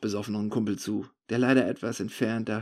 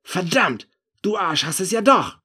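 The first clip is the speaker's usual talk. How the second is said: loud, high accusing outburst; angry cursing; 8 semitones higher, 8 dB louder than usual. Acted. Narrator realising the thief has it, angry cursing. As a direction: curse him as the truth comes out.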